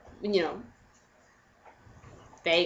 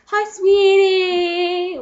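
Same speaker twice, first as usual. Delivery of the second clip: high, slow, sung-out greeting; singsong sweetie; 9 semitones higher, 5 dB louder, much slower than usual. Retold or imitated. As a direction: croon it like a fake lover. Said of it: "And so I was".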